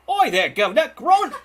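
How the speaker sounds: high, loud mock Cockney British accent. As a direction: ham up a cheeky British accent. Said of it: "with that British accent".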